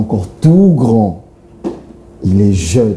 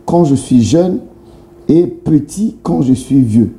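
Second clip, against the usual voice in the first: slow riddle reading; 3 semitones higher, slower than usual. Acted. Host reads a riddle.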